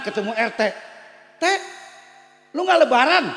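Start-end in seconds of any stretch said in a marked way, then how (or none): 1.4-3.2 high, loud call to a neighbour. Retold or imitated. Driver calls to neighbour RT passing by, loud and high. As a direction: hail him from the car.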